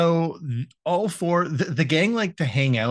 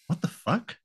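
higher, soft, disbelieving; baffled; 7 semitones higher, 6 dB quieter than usual. Retold or imitated. The friends reacting to Bill's limo date, soft and baffled. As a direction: breathe it out in disbelief. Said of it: "they're just like".

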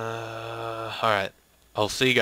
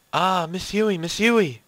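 high, loud panicked calling; alarmed cry; 8 semitones higher, 5 dB louder, slower than usual. Acted. Student calls to dangling teacher, high panicked cry.

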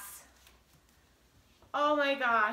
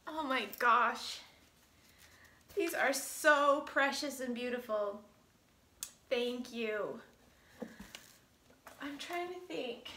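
ordinary delivery

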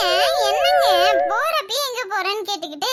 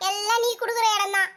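ordinary talk

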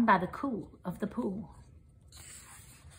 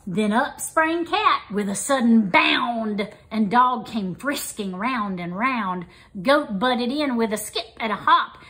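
usual speaking voice

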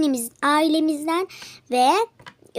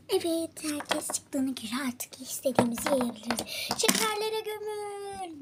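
whispering; 8 dB quieter than usual. Real, not acted. Alone in shop after closing.

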